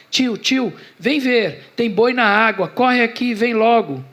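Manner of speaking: put-on child's shouting. Boy Carlinhos calling his uncle to see, shouting. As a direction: call out urgently, pitched well up. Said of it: "começa a gritar alto".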